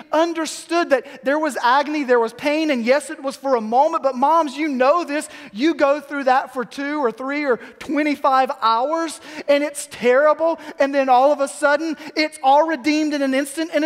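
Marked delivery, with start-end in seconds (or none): none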